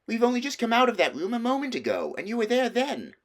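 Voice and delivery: a sister brushing off a silly claim; older sister Susan. A narrator's reading. Susan scolds Lucy.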